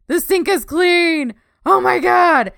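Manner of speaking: high, loud, slow amazement; amazed. Retold or imitated. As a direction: gush over the clean sink. Quoting their own amazement.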